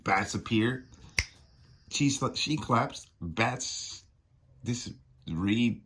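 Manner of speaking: usual speaking voice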